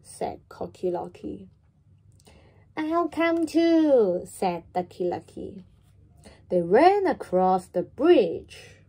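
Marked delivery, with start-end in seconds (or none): 2.8-4.2 high, loud and slow; eager duck. Acted. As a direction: quack it keenly like a little duck. Duck voiced in children's story.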